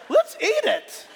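high, loud, hammed-up enthusiasm; mock eager suggestion. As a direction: exclaim it like an absurd bright idea. Mocking whoever first ate calamari.

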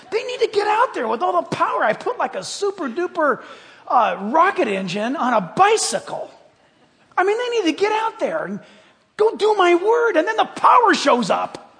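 loud, high, shouting sermon voice; full-force preaching. Acted. Pastor urging the congregation to go, in a loud, high preaching shout. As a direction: preach it out with fire.

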